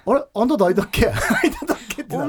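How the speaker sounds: Akita dialect. Pretend. Old classmate at a mock reunion, laughing Akita dialect. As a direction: play puzzled in Akita dialect. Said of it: "ちょっと秋田弁で話してみたい".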